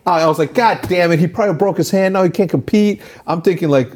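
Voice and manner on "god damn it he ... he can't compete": high-pitched retold dismay; dismayed exasperation. Retelling worry over the puncher's hand, dismayed. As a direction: fret like a manager, pitch raised.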